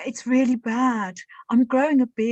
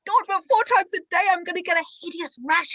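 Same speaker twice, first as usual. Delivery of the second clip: higher, fast; dismayed woman; 6 semitones higher, faster than usual. Acted. Woman fearing a hideous rash, high fast dismay. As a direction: wail it in dismay.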